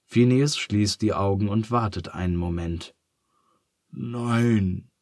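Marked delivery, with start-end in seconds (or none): 3.9-4.9 squeezed-out refusal; a touch of strain. A narrator's reading. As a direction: press the word out. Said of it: "presst er dann hervor".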